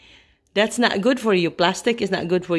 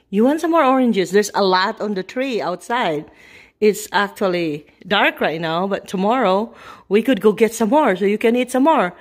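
ordinary delivery

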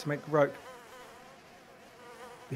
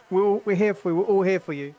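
panto crowd callback; 3 semitones higher, 7 dB louder than usual. Acted. Loud rally round dying friend.

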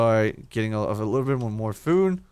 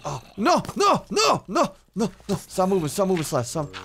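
panicked pleading; 9 semitones higher than usual. Real, not acted. Monsters attacking his character.

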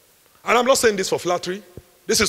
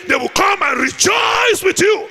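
slow, high shouted promise; fervent preacher's cry; preaching climax; 13 semitones higher, 5 dB louder, slower than usual. Acted. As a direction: crown the point with a shout.

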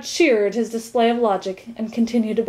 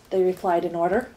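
usual speaking voice

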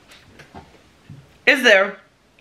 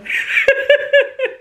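breathy high laugh. Real, not acted. Laughing at friend's reaction.

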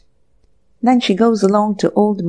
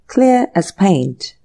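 drawn-out, unimpressed verdict; slow and deliberate; much slower than usual. Acted. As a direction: lay each word down slowly. Stolid inspector verdict.

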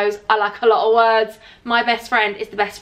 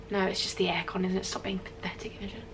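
under the breath, telling herself off; very soft whisper to herself; whispered self-scolding; 3 semitones lower, 13 dB quieter than usual. Real, not acted. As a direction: scold yourself in a hushed voice. Scolding herself after being spooked.

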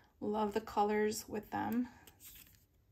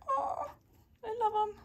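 delighted squeal; 12 semitones higher than usual. Real, not acted. Cooing over cute polar bears.

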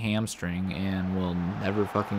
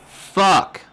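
screamed curse, much higher and louder; furious shout; 11 semitones higher, 12 dB louder than usual. Real, not acted. Gamer screaming at a blunder on screen, full rage. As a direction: let it rip at full volume.

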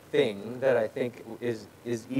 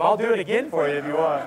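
quick, louder, breaking into laughter; amused; 5 dB louder, faster than usual. Real, not acted. Preacher joking with Siri after it interrupts, laughing. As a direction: say it fast and start laughing.

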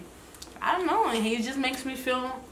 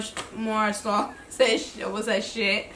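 ordinary talk, nothing marked

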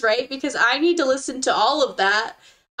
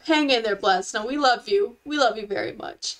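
usual speaking voice

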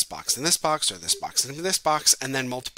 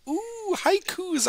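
mock excitement; much slower than usual. Teacher mock-thrilled about haikus.

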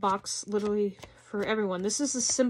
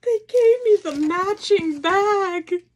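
high, loud, slow squeal of delight; excited squeal; 9 semitones higher, 9 dB louder, slower than usual. Real, not acted. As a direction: shriek it out, overjoyed at the bag. Repeats reveal, squealing louder.

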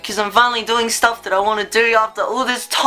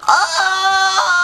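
excited outburst; 9 semitones higher, slower than usual. Real, not acted. Bursting with thanks.

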